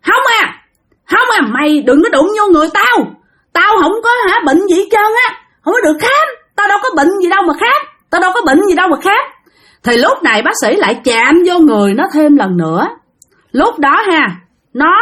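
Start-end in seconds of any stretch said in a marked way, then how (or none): 0.1-9.2 put-on high-pitched child's protest, faster at the end; a boy crying out not to be touched; child yelling. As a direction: feverish boy yells at the doctor, high and fast. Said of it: "nó la làng lên".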